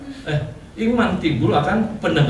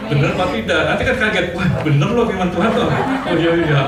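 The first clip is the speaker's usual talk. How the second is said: louder, higher, laughing through it; laughing excitement; 4 semitones higher, 5 dB louder than usual. Real, not acted. Pastor telling the church they'll be surprised God's word works, laughing. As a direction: bubble over with laughter.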